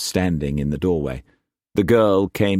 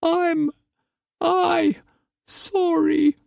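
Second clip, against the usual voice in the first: put-on very high, broken whimper of a young woman; a tiny, halting apology; whimpering girl; much slower than usual. A narrator's reading. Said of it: "she whimpered".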